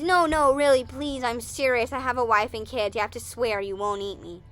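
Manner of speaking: loud, urgent begging bunny, easing off; insistent pleading. Acted. Bunny making the crocodile swear, pleading. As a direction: beg loudly as a worried bunny.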